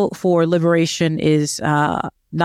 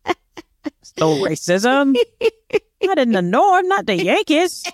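hammed-up incredulous denier, pitch climbing high; sarcastic mock outrage, voice swooping up; mock disbelief; 5 semitones higher than usual. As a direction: play scandalized denial, stretching the words for laughs. Sarcastic mock denial of northern racism.

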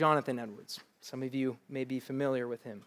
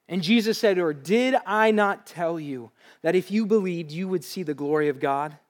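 loud, higher, ringing scripture read; preaching scripture reading; 5 semitones higher, 10 dB louder than usual. Acted. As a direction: proclaim it to the whole room. Reads scripture at full force.

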